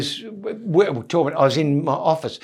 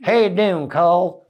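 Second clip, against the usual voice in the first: put-on Southern US accent; 4 semitones higher than usual. Comedian imitating [name]'s old band greeting him, Southern American accent. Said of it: "all talking like that".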